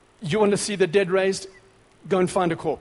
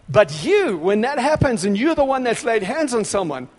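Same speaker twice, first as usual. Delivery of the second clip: rising excitement; 5 semitones higher, 6 dB louder than usual. Real, not acted. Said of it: "building excitement".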